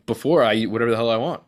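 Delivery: usual speaking voice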